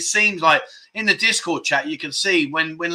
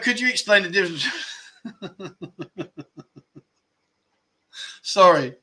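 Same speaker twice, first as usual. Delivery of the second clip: laughing; 4 semitones higher than usual. Real, not acted. Cracking up at a viewer's joke.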